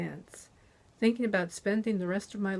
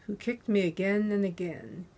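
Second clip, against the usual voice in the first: usual speaking voice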